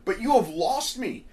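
usual speaking voice

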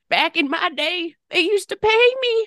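put-on quavery, high old-timer voice; old person voice. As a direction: play a grumbling retiree looking back. Old-timer joke about the past.

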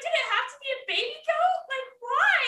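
high, fast, teary mock cry; a high teary whine, fast and half-joking; mock distraught wail. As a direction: whine it like you're about to cry over the goat. Distraught retold reaction to goat.

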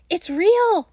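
high, loud delighted burst; excited exclaim. Acted. Gretel tastes the lollipop and cries out, high and thrilled. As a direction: squeal it out with joy. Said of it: "she exclaimed".

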